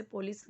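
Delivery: पुलिस as "po-lis"; पुलिस read as written, starting "po"; Hindi spelling pronunciation. Shown as a demonstration. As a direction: say पुलिस with an o in the first syllable. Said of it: "ऐसे पुलिस लिखा होता है".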